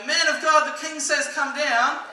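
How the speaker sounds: loud, high-pitched summons; yelling out. Acted. Second band of king's men calling Elijah, yelling out. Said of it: "they say again".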